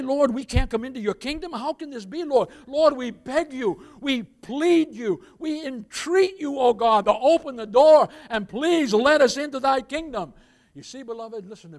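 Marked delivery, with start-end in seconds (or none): 0.0-2.5 loud, desperate protest at the closed door; pleading churchgoers. Retold or imitated. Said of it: "And now you say". 2.7-10.3 slow, loud, drawn-out pleading; imitating desperate believers entreating God, slow and loud; begging God. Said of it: "And now you say".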